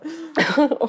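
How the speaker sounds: nervous giggle. Real, not acted. Laughs before answering.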